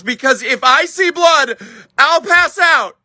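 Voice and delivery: high, emphatic confession; squeamish helper. Retold or imitated. Helper admitting she faints at blood, high and emphatic. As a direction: pitch it up and stress the warning.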